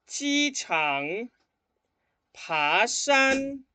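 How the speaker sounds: loud, carefully exaggerated tones, one word at a time; 跟我读的发音示范; repeat-after-me drill. Shown as a demonstration. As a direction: model each word for students to echo. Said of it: "escuchen y repitan después de mí".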